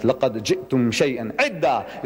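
fast Arabic recitation of the verse; Quran recitation. Acted. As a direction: recite the verse swiftly and evenly.

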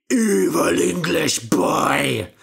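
loud, slow giant roar; angry giant shout. Acted. Giant shouts at Jack chopping, slow loud roar. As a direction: roar it slowly and loudly. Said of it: "shouted the giant".

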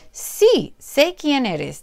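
high, then slow and glad; high bird voice answering; happy little bird. Acted. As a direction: answer mom with joy.

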